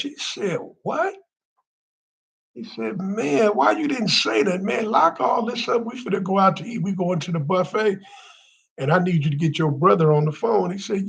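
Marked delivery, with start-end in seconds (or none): 0.8-1.1 high shocked what; a sharp, high-pitched surprised what. Retold or imitated. Brother shocked at the news. 3.0-10.7 excited. Retold or imitated. Excited man voiced in story.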